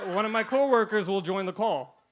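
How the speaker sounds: ordinary delivery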